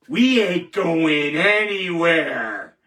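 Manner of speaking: slow, loud, drawn-out declaration; defiant vow. Real, not acted. Host vowing to critics, slow and loud. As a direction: plant your feet and promise it slowly.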